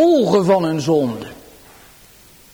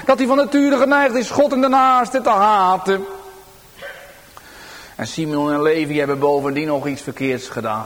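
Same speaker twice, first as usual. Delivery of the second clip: usual speaking voice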